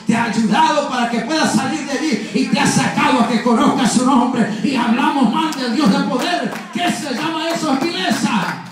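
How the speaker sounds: high, loud, driving sermon delivery; fervent preaching. Real, not acted. Preacher rebuking those who speak ill of God, fervent preaching. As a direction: build to an indignant pulpit cry.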